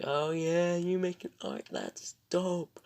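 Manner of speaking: high, loud, stretched-out cheer; hyped-up reaction. Retold or imitated. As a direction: imitate an impressed, hyped buddy. Imitating hyped-up Chester reacting.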